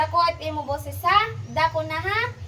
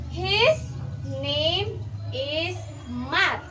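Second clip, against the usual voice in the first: slow, drawn-out reading; slow school dictation; 4 dB quieter, much slower than usual. Shown as a demonstration. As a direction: spell it out for a beginner. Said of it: "let's have here the second sentence".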